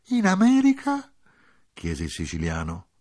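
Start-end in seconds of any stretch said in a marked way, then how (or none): 0.1-1.1 surprised question. Acted. Il siciliano chiede stupito.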